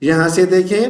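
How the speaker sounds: ordinary talk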